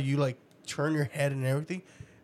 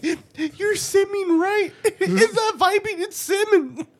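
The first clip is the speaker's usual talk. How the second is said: mock teasing voice; 5 dB louder, slower than usual.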